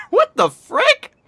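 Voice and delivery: high, slow, startled; stunned disbelief. Real, not acted. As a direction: drag it out in shock. Robot appears, laughing reaction.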